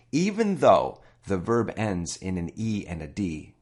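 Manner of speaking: ordinary talk, nothing marked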